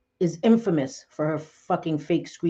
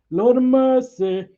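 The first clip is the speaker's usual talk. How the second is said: high, loud and drawn out; exasperated exclamation; 6 semitones higher, 6 dB louder, slower than usual. Real, not acted. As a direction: cry it out high and slow, exasperated. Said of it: "Oh no, Lord of mercy".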